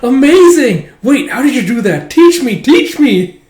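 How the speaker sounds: high, loud awestruck gush; amazed and eager. Acted. Akane amazed by her master's eating mime, high and loud. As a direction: burst out in wonder, then beg.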